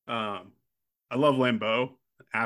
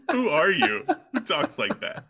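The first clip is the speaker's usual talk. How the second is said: laughing; 6 semitones higher, 4 dB louder than usual. Real, not acted. Laughing at the movie's dialogue.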